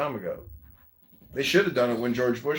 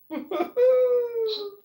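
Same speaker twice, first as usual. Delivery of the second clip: loud cackle; 4 dB louder than usual. Real, not acted. Mocking laugh at Iran.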